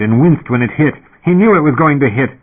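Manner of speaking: plain reading voice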